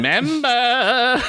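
Goldmember voice. Retold or imitated. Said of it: "I can do Gold Member".

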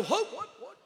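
high, rapid-fire startled questioning; startled confusion. Acted. Worshipper startled by the holler, high confused blurting. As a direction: blurt it high, caught off guard.

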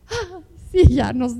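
ordinary delivery

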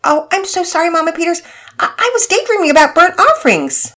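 put-on high young girl's voice, flustered and sorry; apologetic girl. Penelope apologizes to Mama Peters in a high flustered voice.

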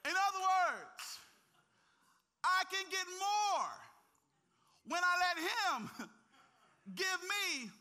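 loud, high preacher's cry; preacher at full force to the congregation; full-force preaching. Acted. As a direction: shout the story's climax to the church. Climax of sermon story, shouting.

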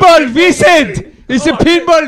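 slow, high shouted correction. Real, not acted. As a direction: bellow the word out. Correcting friend loudly.

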